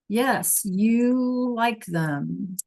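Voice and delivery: very slow you like them, each word drawn out; very slow gloss. Shown as a demonstration. Teacher repeating the translation very slowly. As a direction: stretch it out for the class.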